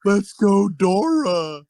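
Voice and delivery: imitating a kid's yell. Kid heckling wrestler at a show, mock yell. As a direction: call it out like a kid heckling from the crowd. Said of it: "some fucking kid is yelling at me".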